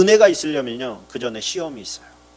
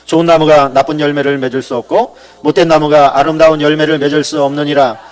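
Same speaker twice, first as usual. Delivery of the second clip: congregational reading; 9 dB louder than usual. Acted. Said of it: "우리 같이 한번 읽어보겠습니다".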